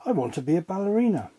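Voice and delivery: a hint of a child's eager wish, rushed; eager little girl. A narrator's reading. Debbie telling her mother her dream, light quick girl voice.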